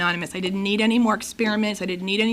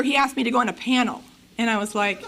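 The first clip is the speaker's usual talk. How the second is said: normal talking voice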